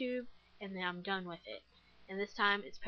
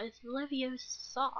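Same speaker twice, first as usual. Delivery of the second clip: slow, higher "Leviosa" drawn out on the "sa"; a light know-it-all mimic; Hermione Granger quote; 5 semitones higher, slower than usual. Quoting Hermione's correction, mock prim. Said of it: "Hermione Granger saying".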